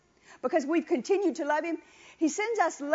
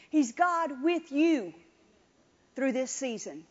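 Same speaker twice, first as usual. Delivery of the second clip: slow emphasis. Acted. Preacher slows on promise.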